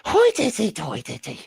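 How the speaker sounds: hammed-up fake Scottish lilt; mock Scottish accent. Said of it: "like, a Scottish accent".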